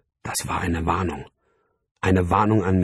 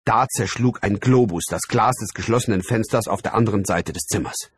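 plain reading voice

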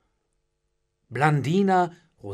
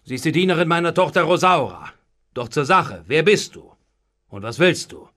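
loud, impatient questioning; gruff; 8 dB louder than usual. A narrator's reading. Master demanding the servant's business, gruff. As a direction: press brusquely for an answer. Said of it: "gab Pandolfo unwirsch zurück".

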